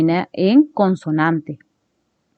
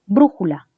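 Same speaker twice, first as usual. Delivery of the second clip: "Brújula" stressed on BRÚ; correctly accented BRÚjula; stress on first syllable. Shown as a demonstration. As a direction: hit the BRÚ of "Brújula", the rest falling. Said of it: "Lo correcto es decir brújula".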